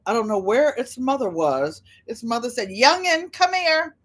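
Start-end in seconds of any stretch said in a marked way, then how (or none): 2.7-4.0 lightly voiced grandma hollering for the youngins; grandma calling the kids. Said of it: "That's what my grandma from down south used to say".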